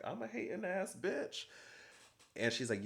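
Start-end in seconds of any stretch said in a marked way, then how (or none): 0.0-1.5 very high, rising disbelief; mock incredulous echo. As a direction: throw the insult back as a squeaky question. [name] echoes accusation in disbelief.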